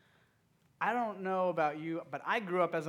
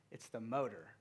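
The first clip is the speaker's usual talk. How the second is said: normal talking voice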